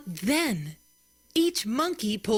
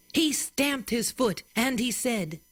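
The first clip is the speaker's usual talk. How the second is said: plain reading voice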